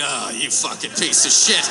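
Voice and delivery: low disgusted mutter; self-loathing mutter. Retold or imitated. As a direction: sneer at yourself in the mirror. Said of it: "you're like".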